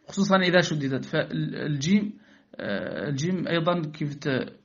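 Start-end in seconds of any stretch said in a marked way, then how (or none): none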